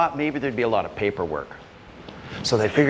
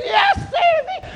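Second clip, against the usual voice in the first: very high, drawn-out shriek; screaming; slower than usual. Acted. Old man running up the stairs, high drawn-out scream. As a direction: shriek it in desperate panic. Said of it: "still screaming".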